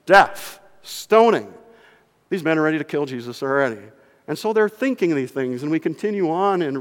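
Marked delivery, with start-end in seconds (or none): none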